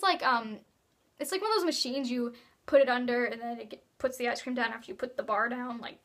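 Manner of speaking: ordinary delivery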